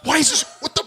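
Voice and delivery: high-pitched baffled outburst; retold exasperation. Builder recalling a desk full of nails, exasperated.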